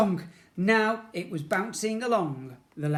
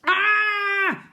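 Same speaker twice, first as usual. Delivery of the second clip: high, loud scream of delight; joyful scream; 10 dB louder than usual. A narrator's reading. Boy on the slime loop screaming with pleasure. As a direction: scream with pure fun. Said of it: "he screamed again, but this time in pleasure".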